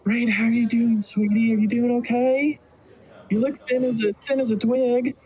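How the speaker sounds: high, doting mama voice; high-pitched motherly concern; fussing elven mother. Acted. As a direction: fuss over your boy like a worried mother. Game master plays Rain's mother.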